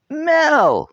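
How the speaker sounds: very high, loud shocked cry of her name; horrified scold. A narrator's reading. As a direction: scold his sister in disgust. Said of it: "scolded her brother, horrified".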